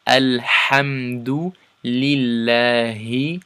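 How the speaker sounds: slow, chanted Quranic verse; Quran recitation. Shown as a demonstration. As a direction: recite the verse in tajweed style. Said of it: "So one more time".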